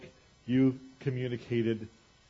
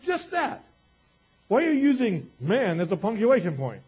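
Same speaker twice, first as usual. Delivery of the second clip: dad's rebuke; 6 semitones higher, 5 dB louder than usual. Retold or imitated. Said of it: "He said".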